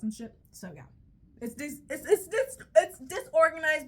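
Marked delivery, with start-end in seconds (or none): 1.4-3.9 flustered outburst. Real, not acted. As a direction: voice climbing in a flustered rush. Flustered self-defence, rising loudness.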